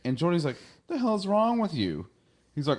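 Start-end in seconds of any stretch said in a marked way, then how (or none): 0.9-2.1 high, irritated mock snap at a clumsy kid; annoyed snap. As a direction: act fed up and bark the question. Geordi snaps at Wesley.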